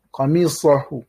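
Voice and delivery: ordinary delivery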